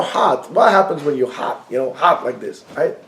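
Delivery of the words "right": ordinary talk, nothing marked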